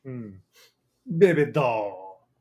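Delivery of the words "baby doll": slow, drawn-out put-on Jamaican accent; imitating a bad Jamaican accent, drawn out; fake Jamaican accent. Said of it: "talks like offensive Sebastian the Crab meets a person doing a Jamaican accent".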